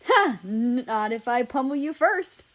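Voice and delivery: loud, high laughing comeback; laughing taunt. Acted. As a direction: toss back a playful threat. Playful counter-threat, laughing.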